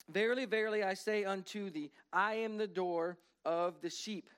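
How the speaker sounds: proclaiming scripture. Acted. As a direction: announce the words of Jesus. Preacher reads Jesus's words.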